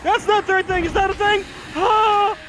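loud, climbing, panicky shout; panicked yelling. Real, not acted. Player pressing the wrong thing while chased, panicked. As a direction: yell as the controls betray you.

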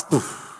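a soft scoffing puff of air; dismissive pfft. Retold or imitated. Believers brush off God's answered prayer, soft scoff. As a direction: blow it off with a scoff. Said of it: "we're like".

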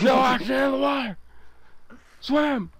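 high, fast panicked shout; yelling while running away. Acted. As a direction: scream it in mock panic. Panic underwater.

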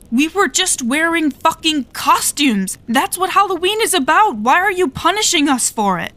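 high, angry panicked outburst. Acted. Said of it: "the angry panic in my voice".

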